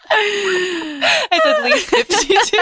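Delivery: high, slow words through laughter; can barely get it out for laughing. Real, not acted. Joke about cohost's age.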